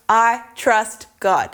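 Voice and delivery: slow, low, settled declaration; released, unhurried assurance; calm trust. Shown as a demonstration. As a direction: say it slowly and low, at ease. Calm after releasing tight hands.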